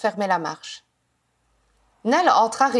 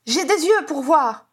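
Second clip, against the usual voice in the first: high, piercing retort; strident; 6 semitones higher, 4 dB louder than usual. A narrator's reading. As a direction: snap it back in a strident voice. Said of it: "assura Janet d'une voix stridente". (réplique d'une voix stridente)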